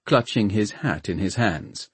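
usual speaking voice

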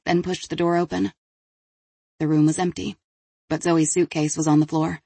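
ordinary delivery

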